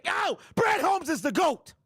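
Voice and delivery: high, slow shouted mockery of fans; jeering impression of the fans, shouted; mocking quote. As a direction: sneer it back at them, hammed up. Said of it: "And what did you say?".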